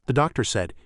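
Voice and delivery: ordinary delivery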